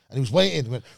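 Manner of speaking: ordinary talk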